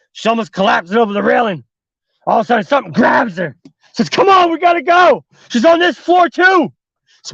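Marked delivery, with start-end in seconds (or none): 4.1-6.7 high, raised, pressing cry; urgent shout. Acted. Man grabbing the girl on the stairs, urgent high shout. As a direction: urge her to move right now.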